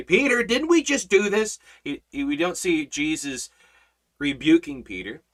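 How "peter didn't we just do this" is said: high, loud imagined scolding; acted outburst of disbelief, pitched up; mock exasperated rebuke. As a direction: play shaking some sense into him. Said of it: "grab Peter by the shoulders and shake him".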